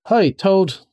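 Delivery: high call across the road. A narrator's reading. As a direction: rat and mole call to Toad. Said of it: "they cried".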